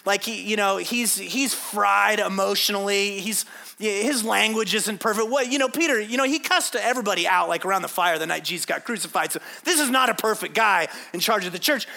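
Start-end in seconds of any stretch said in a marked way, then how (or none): none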